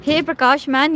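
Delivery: usual speaking voice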